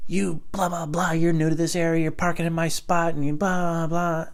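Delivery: high-pitched nagging read-out; mocked nasty note. Neighbor's note about a parking spot, mocking nagging voice. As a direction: mimic a griping note writer up high. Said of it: "they were just such nasty notes".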